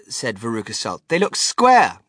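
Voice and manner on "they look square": high, loud bratty insistence; whiny spoiled girl. Acted. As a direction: stamp it out like a brat.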